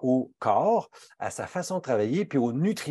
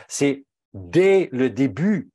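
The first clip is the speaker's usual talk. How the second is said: slow, loud insistence on "dès le début"; emphatic stress; 5 dB louder, much slower than usual. Real, not acted. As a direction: hammer home "dès le début" slowly and loudly. Insisting on early vitamin D.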